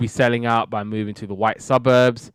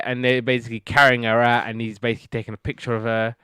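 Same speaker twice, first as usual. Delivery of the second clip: ordinary delivery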